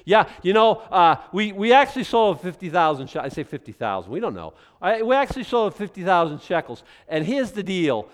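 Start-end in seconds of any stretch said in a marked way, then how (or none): 0.1-6.8 loud, high false confession; hammed-up boastful wife; mock wife boasting. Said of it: "to come clean".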